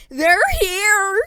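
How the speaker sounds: high shout up the stairs; mom calling out. A narrator's reading. Mom calling daughter downstairs, loud high call. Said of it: "she called me from downstairs".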